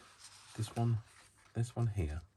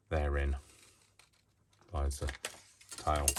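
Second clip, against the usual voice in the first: slow telling; 3 semitones lower, slower than usual. Acted. Dramatic storyteller phrase.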